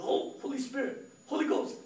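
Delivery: high-pitched retold eureka; excited, pitch leaping up; sudden realisation. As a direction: act out the idea just clicking.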